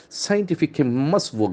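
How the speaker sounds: normal talking voice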